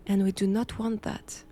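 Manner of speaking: ordinary talk, nothing marked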